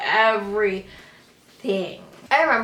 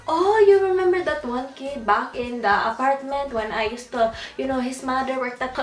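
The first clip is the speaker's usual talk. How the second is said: higher, louder mom voice; mom explaining; 5 semitones higher, 4 dB louder than usual. Retold or imitated. Mom reminding her about that kid, higher and louder. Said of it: "I heard her and my aunt talking".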